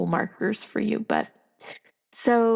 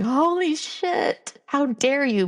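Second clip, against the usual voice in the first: high, very slow mock outrage; high, drawn-out mock indignation; outraged inner child; 6 semitones higher, slower than usual. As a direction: play the child, scandalised. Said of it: "And now he's like".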